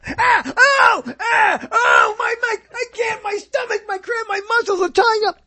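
high-pitched pained yelps into a strained wail; mock cramp agony. Swimmer seized by cramps as the myth has it, mock wailing in agony. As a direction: cry out in exaggerated cramp pain, high and strained. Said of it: "it would be like".

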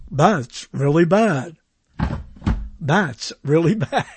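fake cart sound. Shown as a demonstration. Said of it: "this is the fake sound".

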